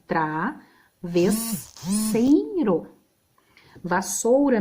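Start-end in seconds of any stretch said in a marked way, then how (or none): 0.1-2.9 Travesseiro split slowly into its syllables, tra-ves-sei-ro. Shown as a demonstration. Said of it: "nesse caso o I vai ficar junto". (Travesseiro separado em sílabas, bem devagar)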